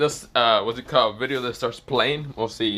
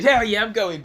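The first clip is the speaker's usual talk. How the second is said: higher-pitched keen reply; eager agreement; 9 semitones higher, 4 dB louder than usual. Acted. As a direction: jump in, raring to go. Acted eager reply in story.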